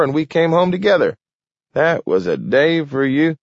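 put-on an old man lost in happy memories of the war's end; Gramp, old man reminiscing. A narrator's reading.

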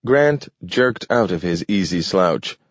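plain reading voice

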